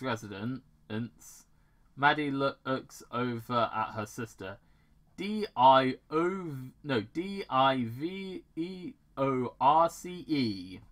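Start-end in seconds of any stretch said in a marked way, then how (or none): 5.2-10.8 spelled out. Retold or imitated. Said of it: "that's how it's said in the movie".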